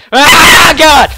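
high, loud cry of alarm; startled yell. Real, not acted. Gamer caught out mid-match, startled shout.

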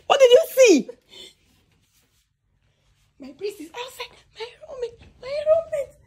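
very high, loud laughing shriek; shrieking laughter. Real, not acted. Vlogger shrieking as her roommate sees the hidden friend, laughing. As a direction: scream it out, half laughing. Prank reveal, screaming and laughing.

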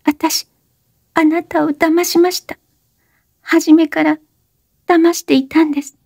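put-on high young woman's voice, urgent plea; breathless high-pitched plea; Oshino, pleading. A narrator's reading. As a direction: beg him to stop talking. Oshino confessing.

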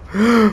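high startled gasp; sharp gasp. Real, not acted. Player gasping as the bear disappears. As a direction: gasp in alarm.

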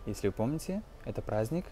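ordinary delivery

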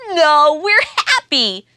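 high, loud laughing reply; laughing happily. Acted. Hare reassuring Mouse through laughter. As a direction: laugh it out with joy. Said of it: "says Hare".

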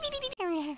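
soft, high little whimper; imitated sleepy whimper. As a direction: whimper like someone dreaming uneasily. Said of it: "whimpers in her sleep".

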